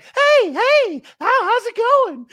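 high squeaky cartoon mouse; high-pitched, loud cartoon greeting; Mickey Mouse voice. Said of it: "Like he talks like Mickey Mouse".

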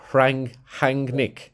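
"Rangnick" slow, with a Spanish-sounding R; halting try at "Rangnick", landing nearer Spanish; Spanish-sounding attempt. Shown as a demonstration. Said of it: "that sounds more Spanish".

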